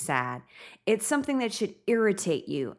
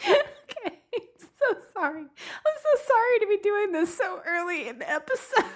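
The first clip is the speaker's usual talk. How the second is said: high, teary apology through laughter; laugh-crying; 13 semitones higher than usual. Real, not acted. Host apologizing to listeners, laugh-crying high. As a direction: apologize while laughing to tears.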